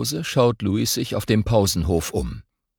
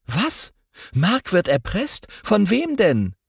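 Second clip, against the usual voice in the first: higher, shocked "Was? Mark wird erpresst?"; startled girl; 11 semitones higher than usual. Acted. Girl shocked to hear a classmate is blackmailed, startled. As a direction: gasp out the news in alarm. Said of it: "Lotta erschrickt".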